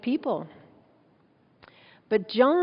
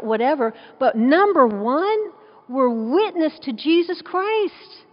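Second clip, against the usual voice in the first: louder, higher pulpit build to a climax; fervent preaching; 5 semitones higher, 5 dB louder than usual. Acted. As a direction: preach it out to the congregation. Preaching rises to full force.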